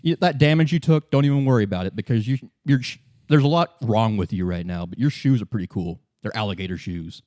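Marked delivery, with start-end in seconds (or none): none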